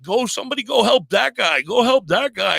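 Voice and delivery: high, loud pleading order; urgent shout. Retold or imitated. Trapped driver yelling at bystanders, high and urgent. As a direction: shout for help for the other driver. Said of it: "I'm like".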